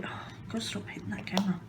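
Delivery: quick whisper to herself; hurried whisper under the breath; whispered aside. Real, not acted. Muttering to herself after bumping the camera, whispered.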